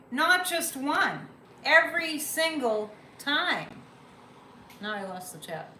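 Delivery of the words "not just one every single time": slow and loud, leaning on every word; drawn-out, punched emphasis; emphatic stress. Shown as a demonstration. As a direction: hammer home every single time. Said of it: "let me emphasize this".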